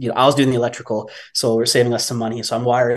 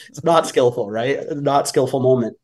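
ordinary delivery

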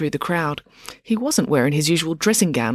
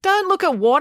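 put-on curious girl; 3 dB louder than usual. April reacts to warning.

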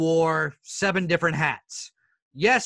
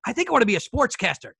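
nervous blurted confession; 7 semitones higher, 4 dB louder, faster than usual. Retold or imitated. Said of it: "calling mom and dad".

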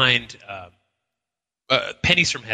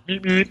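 horn beeps; 8 semitones higher than usual. Retold or imitated. Mimicking grandfather's car horn.

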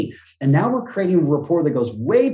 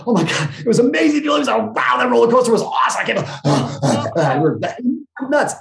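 much higher and louder, gushing, fast in the middle; thrilled roller coaster rave; 9 semitones higher, 3 dB louder than usual. Retold or imitated. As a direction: gush like you just stepped off a roller coaster. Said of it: "The difference is when we're excited".

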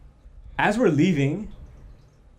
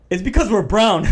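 louder, laughing through the line; laughing quote; 6 semitones higher, 8 dB louder than usual. Retold or imitated. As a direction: crack up while quoting him. Friend's joke retold with laughter.

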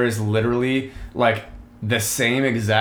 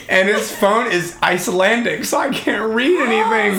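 laughing; 9 semitones higher, 4 dB louder than usual. Real, not acted. Laughing through the story.

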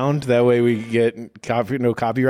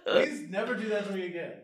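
laughing plea; 9 semitones higher, 4 dB quieter, faster than usual. Real, not acted. Begging after the video.

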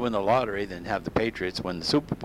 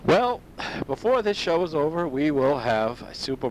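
high excited announcement; 7 semitones higher than usual. Real, not acted.

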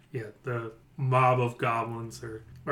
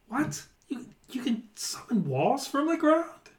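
much higher, squeaky goblin disbelief; startled goblin boss; 12 semitones higher than usual. Acted. Goblin boss sees the illusion wall, high squeaky shock. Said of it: "He sees the brick wall and is like".

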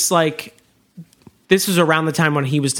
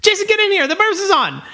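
excited shout; faster than usual. Retold or imitated. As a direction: call your brother in, thrilled. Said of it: "And you're like".

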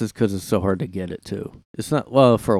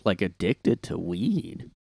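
usual speaking voice

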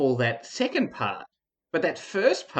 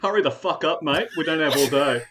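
Aussie voice; 3 semitones higher, 3 dB louder than usual. Pretend. Keeps the Aussie voice.

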